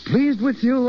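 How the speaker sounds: high, swooping echo of the question; delighted disbelief. Acted. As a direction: throw the question back, amazed. Teacher echoes pupil's doubtful question.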